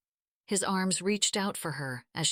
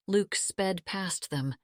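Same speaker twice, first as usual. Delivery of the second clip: ordinary delivery, nothing marked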